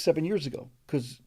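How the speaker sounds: ordinary talk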